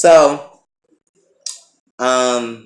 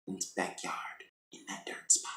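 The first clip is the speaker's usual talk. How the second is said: secretive acted whisper; whispered quote; 5 semitones lower, 20 dB quieter than usual. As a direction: drop to a hushed, voiceless whisper. Acted line trails into whisper.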